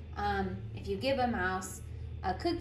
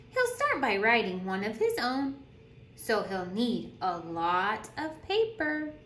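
lively storytime voice, bouncing pitch; animated picture-book reading; reading to children; 3 semitones higher than usual. Acted. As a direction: read it like a teacher at story circle. Reads picture book aloud.

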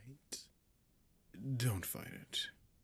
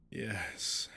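ordinary talk, nothing marked

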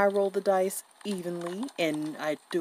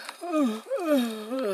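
a long, tired open yawn; real yawn; 7 semitones higher than usual. Real, not acted. Late at night over board games, a real tired yawn. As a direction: let a sleepy yawn slip out. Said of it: "excuse me for yawning".

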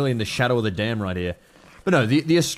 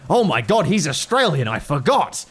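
loud, pitched-up mock amazement; gasping commenters; 4 semitones higher, 4 dB louder than usual. Imitating viewers realising he's Australian, mock amazed. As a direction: exclaim it like an astonished fan. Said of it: "that people went".

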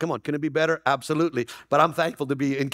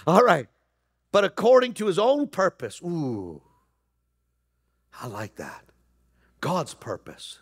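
ordinary talk, nothing marked

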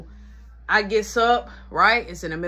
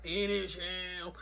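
very low, slow game-announcer voice; Mortal Kombat announcer; 9 semitones lower, 13 dB quieter, much slower than usual. Acted. Joking about mama's finishing blow, deep announcer voice. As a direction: intone it deep, soft and drawn out. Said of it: "Not on some Mortal Kombat".

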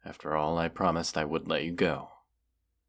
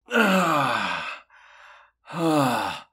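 loud, high pained cries; pained gasps; 9 semitones higher, 7 dB louder than usual. Acted. Dragon as the human yanks out the arrow, pained cries. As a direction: cry out as the arrow tears free.

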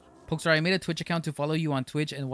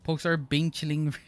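ordinary talk

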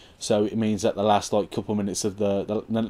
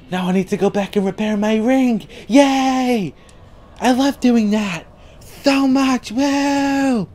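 high, loud fake-happy squeal; sarcastic cheering; 12 semitones higher, 5 dB louder, slower than usual. Real, not acted. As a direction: cheer through your fury, shrill and mocking. Mock cheer at repairing ring again.

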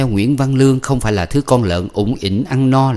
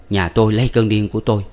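usual speaking voice

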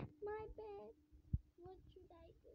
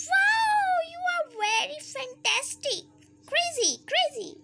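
high, loud delighted wow; amazed; 7 semitones higher, 8 dB louder than usual. Real, not acted. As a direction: burst out in wonder. Amazed at a cake.

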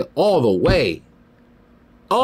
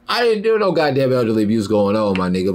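ordinary talk, nothing marked

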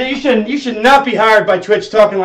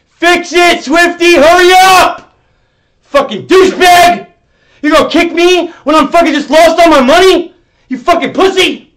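high, loud raging yells at the staffer; furious shouting; 7 semitones higher, 6 dB louder than usual. Real, not acted. Streamer yelling at Twitch staff member in chat, furious shout. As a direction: scream him down, furious.

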